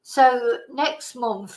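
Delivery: ordinary delivery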